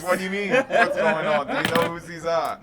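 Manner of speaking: guard's questions broken up by laughter; barely holding it together as the guard; laughing through guard lines. Acted. GM as guard questioning the couple, laughing through it.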